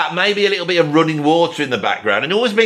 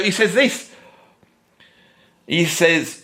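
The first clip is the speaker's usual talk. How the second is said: usual speaking voice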